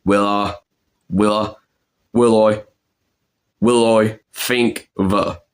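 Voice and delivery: "will I" as "wiw oy", then "fink va"; Cockney pronunciation drill. Shown as a demonstration. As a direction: repeat "will I" carefully before "think the". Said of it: "problem that I'm ... struggling with".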